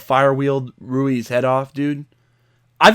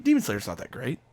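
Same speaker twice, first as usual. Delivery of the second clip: mock dismissive; 8 dB quieter than usual. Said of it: "I was like".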